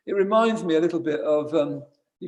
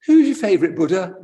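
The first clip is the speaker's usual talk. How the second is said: higher, louder camp Scottish impression; camp Scottish man; 5 semitones higher, 7 dB louder than usual. Retelling a camp Scottish man's question on retreat, mock camp Scottish. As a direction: attempt a camp Scot's lilt, badly. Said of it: "this very camp, Scottish man".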